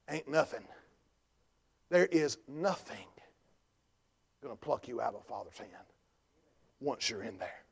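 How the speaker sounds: low, soft, with weighty pauses; hushed preaching. Acted. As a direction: let each phrase settle quietly on the congregation.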